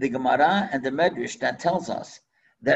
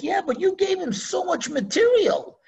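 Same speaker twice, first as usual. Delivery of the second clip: much higher, insistent wife; queen's voice; 9 semitones higher, 3 dB louder than usual. Acted. Queen warning her husband, high insistent voice. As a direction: press the point, pitched up.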